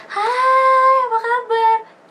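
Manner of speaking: mock cheerful hello; cheery greeting. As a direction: greet a friend brightly. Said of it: "kalau di Indonesia tuh kita kayak cuma".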